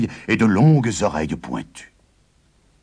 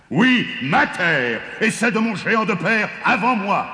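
indignant giant; 12 semitones higher than usual. A narrator's reading. Giant tells the farmer the land is his, indignant. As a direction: stake the claim in a piercing, angry voice.

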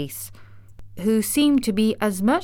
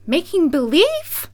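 high-pitched incredulous question; astonished cry; 10 semitones higher, 4 dB louder than usual. A narrator's reading. Dorothy hears of the wizard's pretence, crying out. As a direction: cry it out, rising in disbelief. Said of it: "cried Dorothy".

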